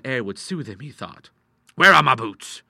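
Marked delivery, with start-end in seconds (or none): 1.7-2.6 loud, raised-pitch question; ruffled demand. A narrator's reading. Ruffled doctor looking for his boots, testy. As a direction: call out testily.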